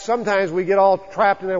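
ordinary talk, nothing marked

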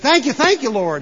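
eager urging. Acted. Rider spurring the preacher's horse on, eager urging. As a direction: urge the horse onward with gusto.

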